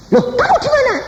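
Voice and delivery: high, startled wife voice; surprised wife. Retold or imitated. Wife on the phone, surprised, high pitched. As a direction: sound caught off guard on the phone.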